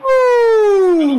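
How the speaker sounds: excited whoop. Real, not acted. Big lead in the game.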